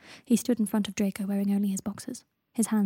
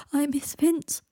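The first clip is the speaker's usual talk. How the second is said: higher-pitched, acted sob; sobbing; 6 semitones higher, slower than usual. A narrator's reading. Greg grieves for his dead friend, sobbing. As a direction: let grief lift the voice. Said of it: "sobbed Greg".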